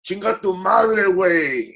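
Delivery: put-on Mexican accent, heavy and punchy. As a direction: throw it out in a thick Mexican accent. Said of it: "in a real, real Mexican accent".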